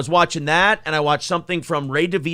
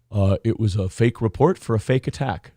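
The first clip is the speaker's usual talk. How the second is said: low, unbothered imitated comeback; deadpan retort; 8 semitones lower than usual. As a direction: drop low and deflate him calmly. Potato calmly deflates Kevin.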